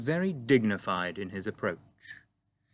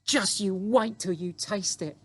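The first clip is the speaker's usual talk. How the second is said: put-on higher, quick little girl's voice; 10 semitones higher than usual. A narrator's reading. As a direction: explain eagerly like a small girl. Sophie voiced in story.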